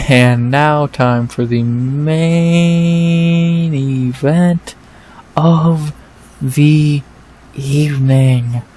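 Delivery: slow, drawn-out sung fight announcement; sing-song main event callout; mock ring announcer. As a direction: stretch every word like the arena announcer.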